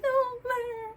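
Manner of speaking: high mock whimper of denial; squeaky, petulant "no"; whiny refusal. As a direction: pout it out like a child. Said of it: "I was like".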